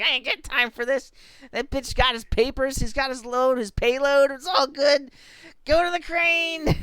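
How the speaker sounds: loud, high mock impatience; hammed-up, high-pitched barking orders; harried child. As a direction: imitate a bossy kid on the job. Imitates child as harried worker.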